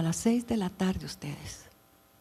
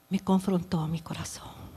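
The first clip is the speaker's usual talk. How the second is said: whispered; 3 semitones lower, 6 dB quieter than usual. Retold or imitated. Telling of being confronted.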